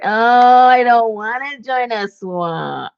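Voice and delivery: hammed-up reluctant whine, slowed down; mock whiny self. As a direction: play your past self, dramatically unwilling. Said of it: "I was like".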